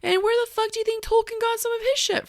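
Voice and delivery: exasperated, way up high; incredulous high rant. Real, not acted. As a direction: pitch shoots up, indignant. Ranting about Tolkien borrowing.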